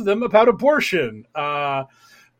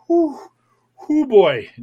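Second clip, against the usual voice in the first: put-on high whew falling into a dismayed "boy"; mock-weary whew of dismay; dismayed exhale; 7 semitones higher, slower than usual. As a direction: blow out a breath at bad news. Reacting to the absurd message.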